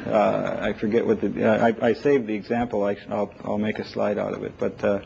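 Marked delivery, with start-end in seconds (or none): none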